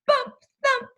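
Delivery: high, loud bump thump; playful bang-bang sound words; crash sound effect. A narrator's reading. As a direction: punch out the crash like a cartoon. Collision sound in story.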